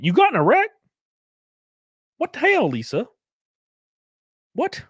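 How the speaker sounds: high, incredulous questioning; real shock. Real, not acted. Streamer learns Lisa got in a wreck, shocked. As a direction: voice leaping up in alarmed disbelief.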